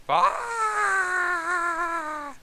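sudden high scream; high shriek. Acted. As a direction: squeal like she got burned. Woman's shriek in story.

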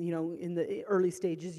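ordinary talk

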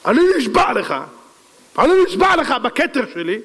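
high, forceful cry of a vow; acted royal oath. King swearing before all the people, outraged. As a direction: proclaim it in rising outrage.

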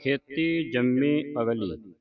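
slow ਖੇਤੀ ਜੰਮੀ ਅਗਲੀ, then stop; pause after ਅਗਲੀ. Shown as a demonstration. Teacher slows down to show the pause after ਅਗਲੀ. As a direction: rest clearly after ਅਗਲੀ. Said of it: "ਇੱਥੇ ਵਿਸਰਾਮ ਲਾਉਣਾ".

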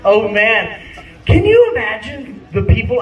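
ordinary talk, nothing marked

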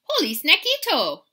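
loud, high delighted outburst; excited exclamation. A narrator's reading. Kitty just ate a fly, exclaiming loudly. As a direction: exclaim it with gusto. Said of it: "exclaimed the kitty boy".

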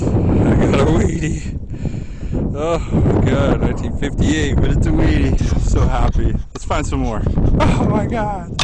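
loud, happy exclaiming; overjoyed. Real, not acted. Digger shouts happily about finding a second wheat penny, overjoyed. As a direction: shout your joy at the find.

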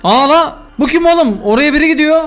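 startled alarm. Real, not acted. Spots an unknown figure in game.

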